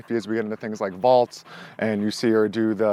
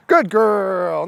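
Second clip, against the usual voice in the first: higher, much louder praise; excited praise; 7 semitones higher, 7 dB louder than usual. Real, not acted. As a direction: cheer her landing. Praising dog after jump.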